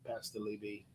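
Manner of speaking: low, deep radio voice. Shown as a demonstration. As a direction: drop the voice deep. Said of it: "I'm talking deep now".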